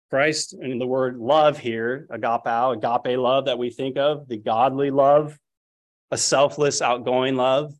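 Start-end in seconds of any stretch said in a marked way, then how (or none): none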